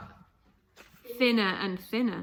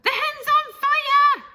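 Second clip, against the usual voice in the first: high, loud, slow alarmed yell; a grandmother shrieking in alarm; Grandma yelling; 11 dB louder, slower than usual. Acted.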